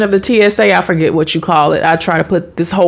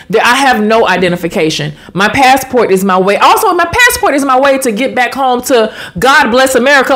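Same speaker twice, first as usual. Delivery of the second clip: ordinary delivery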